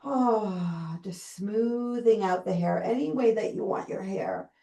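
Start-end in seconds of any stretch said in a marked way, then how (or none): 0.1-1.0 long exhaled "ah"; relaxed sigh. Shown as a demonstration. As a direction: sigh it out as if releasing tension. Sighing while smoothing out hair.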